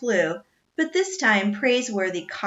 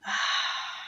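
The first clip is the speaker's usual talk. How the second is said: long soft breathy sigh; tired sigh; 7 dB quieter than usual. A narrator's reading. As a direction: let the anger out in a sigh. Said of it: "He sighed".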